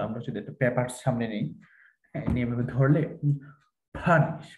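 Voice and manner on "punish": punish with enough air on the p to move a sheet; higher punish with strongly aspirated p; puff that moves paper. Shown as a demonstration. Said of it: "পেপারটা নড়বে".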